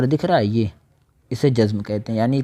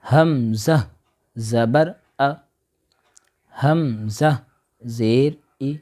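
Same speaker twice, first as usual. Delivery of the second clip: drill recitation; 2 semitones higher, much slower than usual. Shown as a demonstration. Spelling drill for children.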